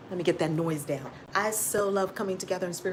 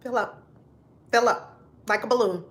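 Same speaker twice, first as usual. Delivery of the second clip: guided breathing; 4 dB quieter than usual. Acted. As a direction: lead them calmly through each breath. Leads a breathing exercise.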